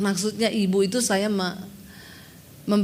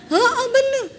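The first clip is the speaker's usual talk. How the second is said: much higher, loud mock voice; high squeaky agreement; 12 semitones higher, 5 dB louder, slower than usual. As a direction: chirp it in a high voice. Woman agreeing in story. (suara tinggi melengking)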